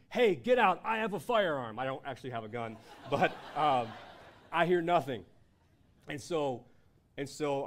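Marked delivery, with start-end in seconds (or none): 0.1-1.8 loud, high-pitched shout at an intruder; acted-out panicked warning yell; retold scream. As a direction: bluff an intruder away at full volume. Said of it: "And so I scream".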